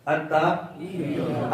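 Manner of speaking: slow "التحیات" with a clear i under the haa; deliberate, stretched "التحیات"; correct pronunciation demo. Shown as a demonstration. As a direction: hold the hii in "التحیات". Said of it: "ہا کے نیچے زیر ہے".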